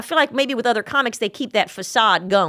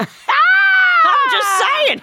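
very high, drawn-out teasing squeal; 6 dB louder, much slower than usual. Real, not acted. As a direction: stretch it out like a playful whine.

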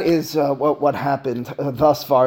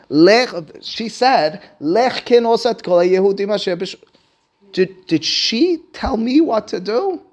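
higher-pitched, hammed-up disbelief; incredulous Mordechai; 6 semitones higher, 4 dB louder than usual. Mordechai cannot believe Esther told him what to do, incredulous. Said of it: "I'm imagining Mordechai in the moment".

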